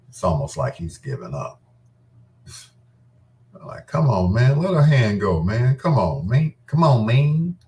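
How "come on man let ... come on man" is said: a hint of Lucius, louder pleading; lightly voiced Lucius nagging a friend to let go; Lucius impression. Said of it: "I turned into Lucius on him".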